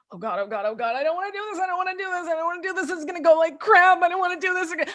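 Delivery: high, panicky whine of dread; anxious inner voice. Retold or imitated. Salesperson dreading the cold call, high panicky whine. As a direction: whimper like a caller dreading the phone.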